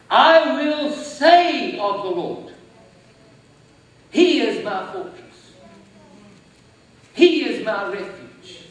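slow, loud psalm reading; solemn scripture reading. Acted. Preacher reading Psalm 91 aloud, slow and loud. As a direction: proclaim each line slowly.

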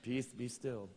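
effeminate mock voice. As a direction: lisp it gently like a dainty man. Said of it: "with an effeminate accent".

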